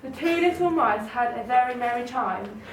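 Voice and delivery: usual speaking voice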